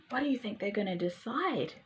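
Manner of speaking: plain reading voice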